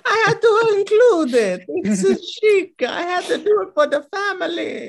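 very high, loud cartoon Italian; mock Italian accent. Defending the Gucci pick to friends, hammed-up Italian accent. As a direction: wail it like an over-the-top Italian. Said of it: "You're turning into Mario".

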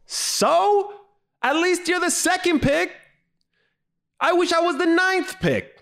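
very high-pitched mock outrage; hammed-up high exasperation; exasperated. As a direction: squeal the comeback in comic disbelief. Exasperated comeback to the retold complaint.

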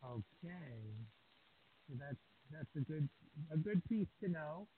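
distant, faint voice off the microphone; speaking far from the microphone; far from mic. Real, not acted. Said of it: "It's just a little h-, difficult".

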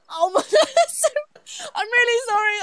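high squeal through real laughter; helpless laughter. Real, not acted. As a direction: apologize while cracking up. Cracking up after own Texan impression.

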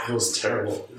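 ordinary delivery

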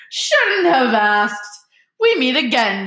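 loud, high, drawn-out jolly boom; thundering jovially. A narrator's reading. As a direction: bellow it grandly like a hearty showman. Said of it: "thundered jovially".

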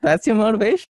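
mock disbelief. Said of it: "I was like".

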